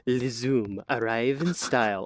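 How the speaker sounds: slow, smooth French ad voice; French accent. Pretend. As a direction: purr it like a French advert. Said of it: "someone in a French accent going".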